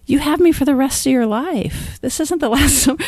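a hint of a teenager talking Mom down; retold teenage son. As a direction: imitate a teen setting Mom straight, laugh creeping in.

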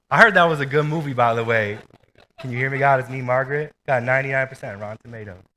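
amused aside. Real, not acted. Laughing aside after journal joke.

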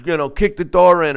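imitating a cop calling occupants out; cop ordering out. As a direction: bark the order through the door like a SWAT cop. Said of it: "they're telling".

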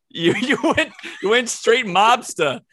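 high, helpless laughing tease; cracking up. Real, not acted. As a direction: talk through real laughter. Cracking up at the failed impression.

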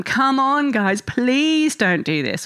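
mild pleading, rising high then drawn out slow; half-hearted parental plea, slowed on please. Retold or imitated. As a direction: coax the kids, a touch begging. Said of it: "try and plead with them".

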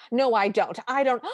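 Drizella. Retold or imitated. Stepsister denying it to her mother, mock protest. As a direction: imitate a petulant stepsister protesting. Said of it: "And Drizella goes".

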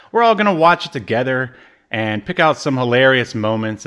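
normal talking voice

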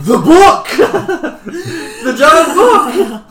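loud, high hammed-up shout; mock awakened character. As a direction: shout it like waking in a panic. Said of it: "He's gonna wake up and go".

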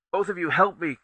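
loud, high, urgent call for help; urgent cry. Acted. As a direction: shout it at both of them, pressing. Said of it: "Tay cried".

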